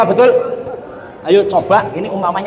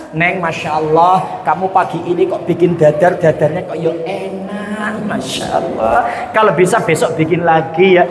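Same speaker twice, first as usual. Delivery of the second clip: gentle praise; 4 semitones lower than usual. Acted. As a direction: savour every word of praise. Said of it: "ngomongnya lembut".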